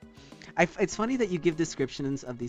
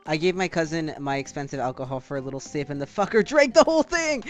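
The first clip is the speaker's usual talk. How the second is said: starts calm, then bursts out higher and louder; calm turning angry; 10 semitones higher, 8 dB louder than usual. Real, not acted. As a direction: build from calm to a laughing rant.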